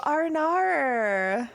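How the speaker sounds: high, drawn-out Australian "Arnar"; Australian accent. Shown as a demonstration. Showing how I get into it, Australian accent on "Arnar". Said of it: "that's how I get into it".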